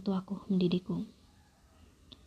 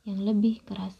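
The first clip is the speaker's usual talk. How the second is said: usual speaking voice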